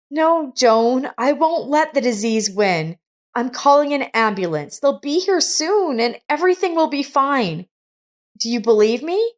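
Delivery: a hint of determined worry; lightly voiced young woman. A narrator's reading. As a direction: reassure her with urgency. Monica's voice, urgent.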